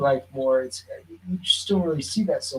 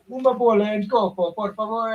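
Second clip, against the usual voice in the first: higher-pitched, careful Portuguese ordering voice; acted-out gelato order; 6 semitones higher than usual. At the gelato place ordering in Portuguese, acted-out customer voice. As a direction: re-enact a polite customer trying out Portuguese.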